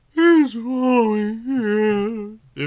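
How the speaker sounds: very slow, high, tearful wail; sobbing. Acted. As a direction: drag the words out as if crying. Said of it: "Yvonne sobbed".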